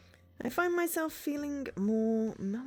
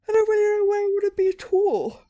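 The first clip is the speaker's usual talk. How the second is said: overwhelmed outburst; 12 semitones higher, 14 dB louder than usual. Acted. Lost after work, reading inner thoughts.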